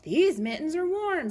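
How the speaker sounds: high, loud happy exclamation; lightly acted gleeful outburst; delighted boy. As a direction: show off new mittens excitedly.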